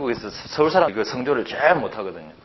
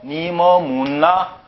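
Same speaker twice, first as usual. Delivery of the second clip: drawn-out, even-toned Seoul reading of "니 뭐 묻나"; exaggerated slow Seoul intonation; Seoul accent; 2 semitones higher, much slower than usual. Shown as a demonstration. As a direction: read it very slowly as a Seoul speaker would. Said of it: "이걸 서울 사람 말로".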